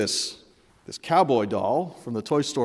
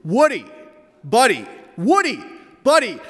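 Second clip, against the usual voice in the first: acted sibling bickering, building from insistent to high and loud; escalating squabble; 7 semitones higher, 6 dB louder than usual. Father acting out two bickering sons, escalating squabble. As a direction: trade the words back and forth, rising each time.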